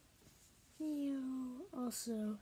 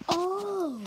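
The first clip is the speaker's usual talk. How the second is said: sudden loud oh; jolted surprise; startled gasp; 7 semitones higher, 12 dB louder than usual. Real, not acted.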